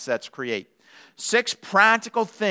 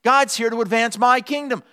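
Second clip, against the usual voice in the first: lightly voiced cat, pitched up and assured; higher, self-important proclamation; a hint of entitled cat; self-centred cat; 6 semitones higher than usual. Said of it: "A cat says".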